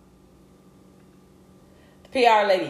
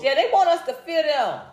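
normal talking voice

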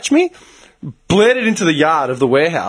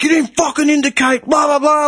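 retold road-rage yelling; mock screaming; 10 semitones higher than usual. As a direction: imitate a raging driver, pitched up. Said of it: "he's screaming at me".

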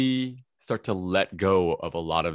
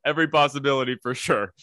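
talking through laughter, higher and louder; laughing; 8 semitones higher, 6 dB louder than usual. Real, not acted. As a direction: break up laughing as you answer. Laughing at the security warning.

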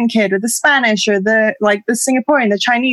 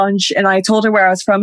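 ordinary talk

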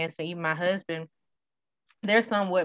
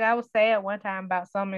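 ordinary delivery

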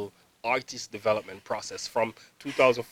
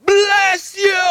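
high, loud shout of bless you; 9 dB louder than usual. Retold or imitated. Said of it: "the lady just shouted".

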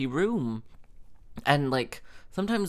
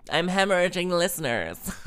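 higher, breaking into a laugh; amused outburst ending in laughter; 6 semitones higher than usual. Real, not acted. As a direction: laugh through the words at yourself. Laughing at own rant.

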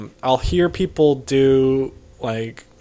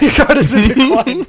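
higher, louder mocking cashier voice, laughing; 11 semitones higher, 9 dB louder than usual. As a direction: sneer at a customer like a snippy clerk.

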